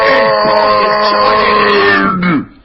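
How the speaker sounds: slow, very loud stretched-out roar; booming drawn-out charge call; drawn-out yell. Real, not acted. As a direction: bellow the charge across the arena. Final charge at Vlad.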